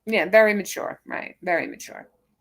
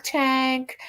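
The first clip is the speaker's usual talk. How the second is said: tank repeated higher; stuttered repeat; 6 semitones higher than usual. Shown as a demonstration. Mocking the rival's stutter, tank said again higher. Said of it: "and now we got the stutter".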